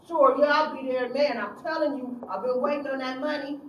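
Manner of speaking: put-on loud, keen teen voice; eager youth. Youth eager to collect his check, loud and keen. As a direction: sound hungry for that money.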